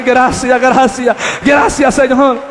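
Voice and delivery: loud, joyful cry of thanks; retelling his cry of thanks to God, shouted. Retold or imitated. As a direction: shout your thanks to the Lord. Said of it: "Y yo empecé a gritar".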